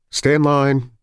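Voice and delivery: loud, high-pitched order; instructing doctor. A narrator's reading. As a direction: call it out down the line. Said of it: "the doctor with the clipboard was instructing".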